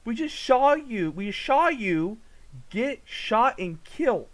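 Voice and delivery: high, loud disbelief, slowing down; incredulous outburst. Real, not acted. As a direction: loudly demand an explanation, stretching the words. Shocked a killed character reappears.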